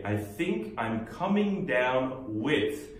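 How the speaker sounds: extremely slow, word by word; very slow model phrase. Shown as a demonstration. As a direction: stretch every word for the class. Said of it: "뭐뭐하고 같이 내려가고 있다".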